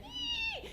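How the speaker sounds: very high imitated dog whine. Imitating her dog Ernie's whining plea. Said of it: "that one pitch that they know is gonna get you".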